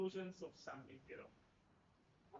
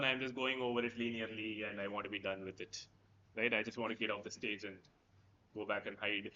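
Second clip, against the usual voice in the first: mock monotone; 11 semitones lower than usual. Shown as a demonstration. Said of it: "you go monotonically".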